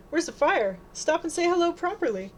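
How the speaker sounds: mom's indignant call; motherly scolding. A narrator's reading. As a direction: call a rushing daughter back to her manners. Mother scolds daughter rushing off.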